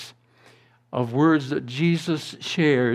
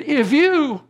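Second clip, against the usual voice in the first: anguished shout; 9 semitones higher, 3 dB louder, slower than usual. Retold or imitated. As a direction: cry out in grief, then let it die away. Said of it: "shouted himself".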